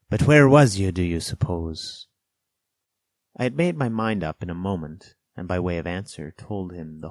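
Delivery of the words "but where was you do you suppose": challenging. A narrator's reading. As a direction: throw the question out sharply, then ease. Castaway challenges the boy.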